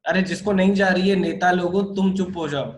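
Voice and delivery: loud scolding. Real, not acted. Teacher silencing show-off students.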